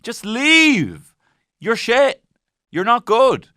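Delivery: high, loud mock rant; hammed-up fury at a contestant; mock angry. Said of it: "the feeling I have towards Rowan".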